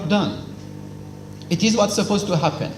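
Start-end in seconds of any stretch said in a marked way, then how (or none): none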